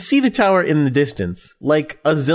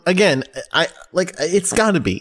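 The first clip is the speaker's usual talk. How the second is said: ordinary talk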